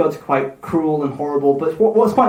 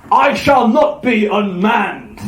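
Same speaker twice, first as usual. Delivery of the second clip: slow, raised god's proclamation; thundering; 4 semitones higher, much slower than usual. Acted. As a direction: thunder it out slowly as an indignant god. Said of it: "Thor thundered".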